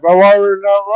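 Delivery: loud, high indignant retort; indignant shout. Acted. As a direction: fire it back, offended and loud. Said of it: "I'll be like".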